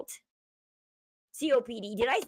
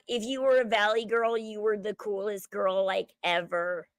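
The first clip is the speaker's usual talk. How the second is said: usual speaking voice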